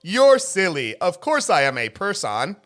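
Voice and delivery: high, loud fake-cheerful robot; canned chirpy laugh, then reassure; robocall voice. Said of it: "Every time I hear one of those robots".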